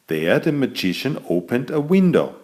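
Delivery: usual speaking voice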